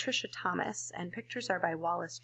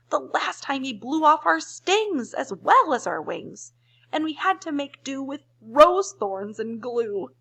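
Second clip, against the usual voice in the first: lightly voiced pleading bees, louder; 6 semitones higher, 7 dB louder, slower than usual.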